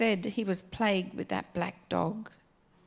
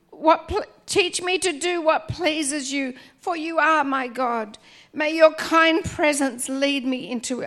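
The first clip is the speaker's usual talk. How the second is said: raised, pleading psalm reading; fervent scripture reading; 3 semitones higher, 6 dB louder than usual. Acted. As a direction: pray it aloud with fervour.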